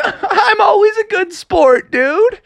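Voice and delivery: high, laughing fake innocence; wolf voice. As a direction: chuckle through an innocent excuse.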